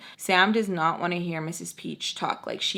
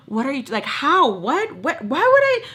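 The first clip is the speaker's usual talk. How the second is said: gasping, fragmented questions climbing high; confused; 6 semitones higher, 4 dB louder than usual. Retold or imitated. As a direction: stammer out bewildered questions, imitating her. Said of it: "So confused".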